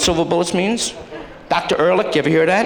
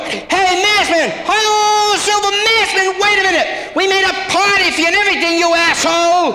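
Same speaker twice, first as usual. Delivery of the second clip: exasperated yell; 12 semitones higher than usual. Acted. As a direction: scream after him as he drives off. Neighbour yelling after the hero again.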